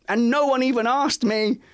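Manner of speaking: put-on high, hurt child's voice; aggrieved daughter. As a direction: feel left out, indignant. Daughter complaining to teacher.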